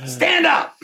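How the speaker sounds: high-pitched mock command; retold urgent order. Dad turning to his son, high urgent order through laughter.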